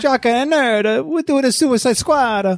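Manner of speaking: very high cartoon Italian voice; Mario. Illustrator announcing the show as a cartoon Italian plumber. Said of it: "it's like a Mario thing".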